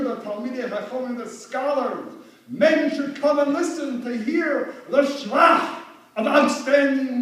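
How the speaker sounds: preaching at full force. Acted. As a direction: proclaim the law to the congregation.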